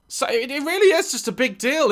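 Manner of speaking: ordinary talk, nothing marked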